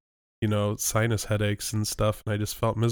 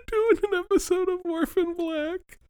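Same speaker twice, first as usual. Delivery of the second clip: very high cartoon squeak; high squeaky voice. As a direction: answer in a tiny high voice. Answering in squeak voice.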